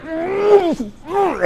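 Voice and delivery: high strained mock grunts; tense grunts. Coach imitating a tensed-up fighter's high strained grunts. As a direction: squeeze out tight little grunts. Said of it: "they're like".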